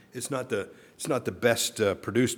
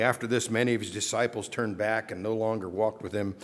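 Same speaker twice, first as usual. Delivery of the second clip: usual speaking voice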